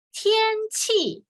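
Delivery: "天" high and level, "气" falling; 示范天气的声调; tone demo. Shown as a demonstration. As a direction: model "天气". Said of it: "天 is 第一声".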